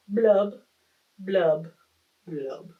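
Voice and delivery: fading glum fish bubbles; gloomy fish blubs. Acted. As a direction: let each blub sink away. Fish's refrain ending.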